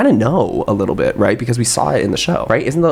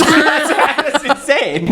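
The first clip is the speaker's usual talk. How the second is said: laughing disbelief; much slower than usual. Real, not acted.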